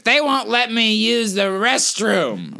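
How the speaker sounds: crazy lady voice. Retold or imitated. Man imitating a crazy lady outside a donut shop, slow and whiny. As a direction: drag it out like a ranting stranger. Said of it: "she's like".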